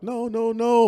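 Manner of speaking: high, urgent mimicked objection; mock alarmed protest. Teacher chasing after the father and son, mock alarm. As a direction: imitate a teacher calling out in protest.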